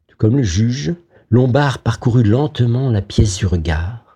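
ordinary delivery

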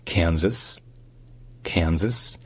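Kansas as "KAN-zəs", stressed on first syllable; Kansas, final s sounded; American pronunciation. Shown as a demonstration. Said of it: "ça se dit".

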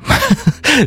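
a high burst of real laughter. Real, not acted. Radio host laughing at the kids' song lyrics, high real laugh.

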